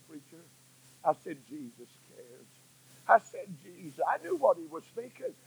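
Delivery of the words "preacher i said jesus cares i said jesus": near-whispered, tender reassurance; preacher gently consoling the weeping pastor in a hushed voice; hushed comfort. Retold or imitated. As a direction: softly comfort a weeping old friend. Preacher consoling the weeping old man.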